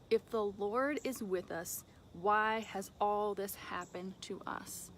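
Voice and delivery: drawn out, deliberate reading of the question; slow scripture reading. Acted. Gideon questioning the angel, read slow and deliberate. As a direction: read Gideon's words slowly, letting them sink in.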